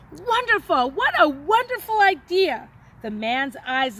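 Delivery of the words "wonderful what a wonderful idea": high, louder joyful exclaim; delighted. A narrator's reading. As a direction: burst with relief and joy. Stranger delighted by plan.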